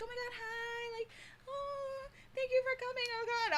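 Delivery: high, soft, timid gushing; docile fan. Retold or imitated. Other girls mimicked as docile fans, high and soft. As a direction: gush meekly like a shy fan. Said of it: "very, like, docile".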